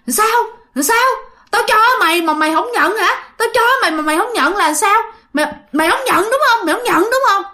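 high-pitched, rapid angry demands; fierce menacing woman. Acted. Strange woman confronting mother who refused the baby, fierce. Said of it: "mà gương mặt bà trông rất là dữ".

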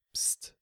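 short soft psst; hissed shush. Acted. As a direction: hush her with a hiss. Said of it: "zischte Dimitri noch einmal".

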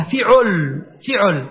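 فعل with i after f, u after ʕ; i then u. Shown as a demonstration. Teacher showing the neglected pattern, "fiʕul". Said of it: "كسر فاء الكلمة وضم عينها".

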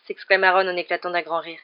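plain reading voice

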